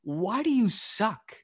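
mock exasperation. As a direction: ask it like they're unbelievable. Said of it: "It's just like".

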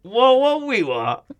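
slow, high comic catchphrase; Borat. Acted. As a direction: stretch it out in a put-on foreign accent. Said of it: "something like about Borat".

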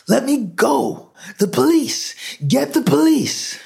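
very high, hysterical cries; panicked screaming. Acted. Man struggling loose from hands that caught him, screaming.

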